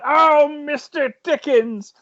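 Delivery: high, drawn-out hammed-up exclamation; imitated gleeful yell. Poirot in his bunk delighting in Dickens, imitated high yell. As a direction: imitate a reader crying out in delight. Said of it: "yelling out".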